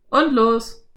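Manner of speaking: loud cowboy call; cowboy calling to ride off. A narrator's reading. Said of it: "rief Slim". (Cowboy ruft laut)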